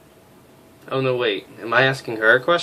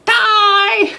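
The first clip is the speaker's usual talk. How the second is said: much higher, louder comic shriek; hammed-up shriek; 11 dB louder than usual. Major snapping at a cheery officer, mock shriek. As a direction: scream it like a cartoon villain.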